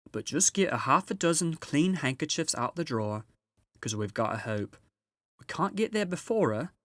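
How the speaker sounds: a brisk, busy housewife giving orders; Mrs Beaver. Acted.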